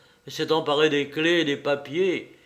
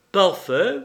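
louder, higher approval; said aloud; 6 semitones higher, 6 dB louder than usual. A narrator's reading. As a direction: announce it for him to hear. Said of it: "prononça-t-il à voix haute".

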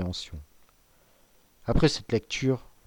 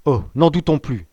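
louder, higher outburst; sudden exclamation; 4 semitones higher, 7 dB louder than usual. A narrator's reading. Magistrate suddenly sure of the danger, crying out. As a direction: burst out as the doubt vanishes. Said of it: "s'écria-t-il tout à coup".

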